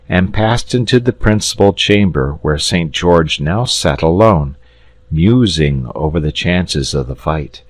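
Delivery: ordinary delivery, nothing marked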